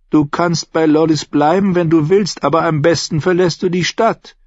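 leicht angedeuteter Tyrion, eindringlich; rät ihr ernst zur Flucht. Acted. Tyrion drängt Shay. (Tyrion, angedeutet, eindringlich)

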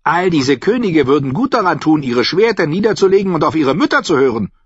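high, loud voice of a mocking old noblewoman; a sly, tart old lady holding court; Olenna Tyrell. A narrator's reading. Olenna mocking a rival to Sansa, high and loud.

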